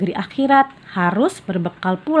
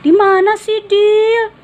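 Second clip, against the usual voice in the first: much higher, louder nagging; insistent plea; 7 dB louder, slower than usual. Acted. Rehan presses Fadil again, high and insistent. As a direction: keep pestering him. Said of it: "pinta Rehan lagi".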